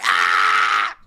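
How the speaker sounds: short low yell; mock scream back. Fan retelling his reply to [name], short mock yell. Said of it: "And I was like".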